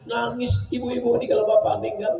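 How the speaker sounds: ordinary delivery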